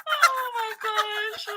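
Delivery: high, drawn-out gasp through laughter; helpless laughter. Real, not acted. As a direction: squeal it out, cracking up. Cracking up at the texts.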